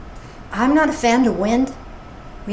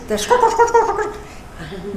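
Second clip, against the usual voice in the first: squeaky toy imitation, higher then drawn out slow; rubber chicken squawk; 8 semitones higher, slower than usual. Speaker describing donkey's squeaky chicken toy, mimicking squawks.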